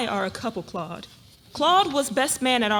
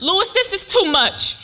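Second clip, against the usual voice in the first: louder, slow, at the breaking point; overwhelmed outburst; 6 semitones higher, 8 dB louder than usual. Acted. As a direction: let it burst out. Wife overwhelmed, slams hand.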